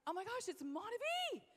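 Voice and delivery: thrilled reveal, acted out; retold excitement. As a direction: gush it, rising high on the product's name. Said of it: "you know, with excitement".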